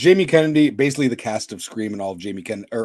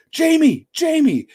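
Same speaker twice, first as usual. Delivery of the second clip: imitated high-pitched call, falling off; 3 dB louder, slower than usual. Someone hailing Jamie from the Winnebago, imitated calling out. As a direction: call a name as if from a vehicle.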